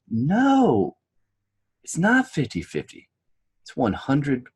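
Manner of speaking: high, slow, weighty speaker's verdict; pause, then deliver it like a famous stage speaker; Jim Rohn's voice. Retold or imitated. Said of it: "and in his voice... he's got a great voice".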